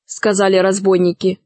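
usual speaking voice

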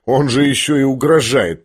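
ordinary delivery

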